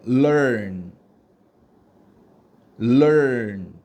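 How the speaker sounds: LEARN drawn out as 럴 sliding into 언; loud model "learn", curled 럴 melting into a final n; 럴 gliding into 언. Shown as a demonstration. Teacher models learn for students to copy, 럴 gliding into 언. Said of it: "럴이라고 말하다가 갑자기 언으로 발음하는".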